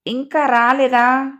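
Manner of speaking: impatient. A narrator's reading. Lalita returns to ask about the paper, impatiently. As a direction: let the annoyed wait stretch the words slowly. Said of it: "విసుగ్గా చూస్తూ".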